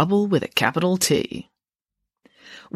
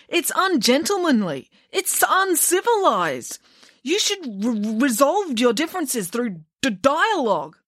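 higher, slow, prissy stammer; prim stammering boy; 9 semitones higher than usual. Acted. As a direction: scold like a fussy little prefect. Joe lectures primly, stammering.

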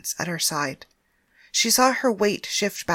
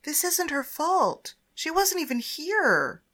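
insisting; 8 semitones higher, slower than usual. Acted. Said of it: "Penthesilea insisted".

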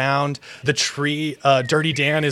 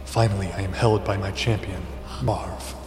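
voice in the head; 6 semitones lower, 6 dB quieter than usual. Acted. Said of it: "a voice speak to you in your head".

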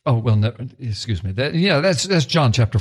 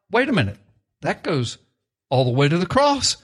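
ordinary talk

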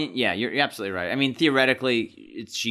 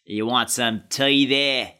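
very slow, overdone British accent; exaggerated matey British, drawn right out; 3 dB louder, much slower than usual. Shown as a demonstration. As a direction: ham up a British offer of tea. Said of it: "my British accent".

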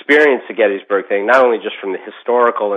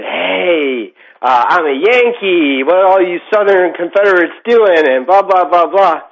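higher, drawn-out mock taunt; taunting provocation; 7 semitones higher, 3 dB louder than usual. Yankee visitor calling to Confederate ghosts from a bridge, taunting. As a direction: call out to provoke the spirits. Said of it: "this is where, you know, the provocation thing".

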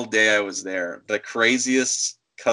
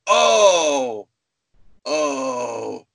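dawning realization; 3 semitones higher than usual. Retold or imitated. Clerk finally understands the customer, dawning realization. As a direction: let the penny drop, big then deflated.

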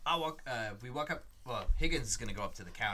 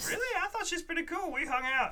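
high character voice; 3 dB louder than usual. Acted. Character gushing about Amy.

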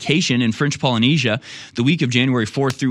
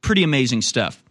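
ordinary delivery

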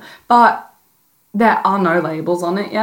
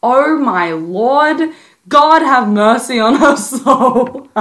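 higher, hammed-up exasperated boyfriend, dragging it out; mock the boyfriend's disbelief, louder at the end, breaking into a laugh; a boyfriend sighing to the heavens, mock pious and laughing; 5 semitones higher, 3 dB louder, slower than usual. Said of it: "He'd be like".

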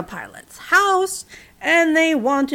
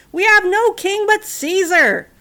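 higher, louder, slow proclamation; loud declaration; 8 semitones higher, 6 dB louder, slower than usual. A narrator's reading. High priest declaring loyalty to Caesar, loud slow proclamation. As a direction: proclaim it defiantly to Pilate.

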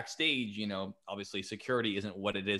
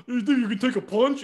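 put-on much higher, loud sneering punk kid; punk kid taunt; 12 semitones higher, 9 dB louder than usual. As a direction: play the cocky kid daring him, pitched way up. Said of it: "this punk ass kid comes up to him and goes".

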